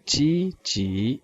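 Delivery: ची ची stretched out very slowly, both syllables level; slow tone demo. Shown as a demonstration. Teacher modelling ची ची to students, very slow. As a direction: draw out ची ची slowly for the class. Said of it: "next word हमारे पास है ची ची".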